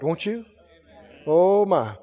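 normal talking voice

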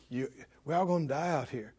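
low, hushed and quick; enemy's planted thought. Acted. Preacher voices the enemy prompting the people, low and hushed. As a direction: drop right down, as if slipping a thought into someone's head. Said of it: "He says".

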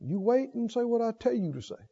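very soft, checking voice; hushed restraint. Acted. The Lord stopping the preacher mid-outburst, hushed and firm. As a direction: rein someone in under your breath. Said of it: "get a hold of me and go".

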